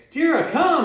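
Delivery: high, loud recall call; calling the dog. Shown as a demonstration. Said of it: "when you call 'em, you say".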